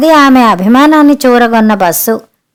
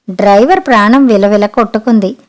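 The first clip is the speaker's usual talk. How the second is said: usual speaking voice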